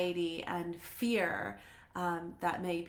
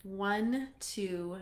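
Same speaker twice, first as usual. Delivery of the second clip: soft, calm meditation voice with long pauses; guided meditation; 5 dB quieter than usual. Real, not acted. Host guiding viewers through a heart activation, soft meditation voice. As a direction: gently guide them inward, leaving room to breathe.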